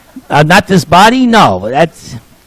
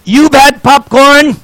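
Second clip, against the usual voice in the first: put-on high, slowed announcer in a Latin accent; Latin-accented announcement, pitched up high; heavy Latin accent; 7 semitones higher than usual. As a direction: mimic a Latin-accented hawker pitching to the crowd. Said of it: "heavy Latin accent".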